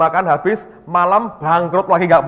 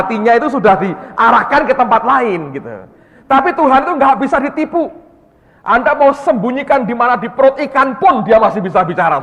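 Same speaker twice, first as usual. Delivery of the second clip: raised, higher preaching voice; preaching loud; 7 semitones higher, 4 dB louder than usual. Real, not acted. Preacher warning the congregation that God sees all, loud and high. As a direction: drive the point home to the crowd.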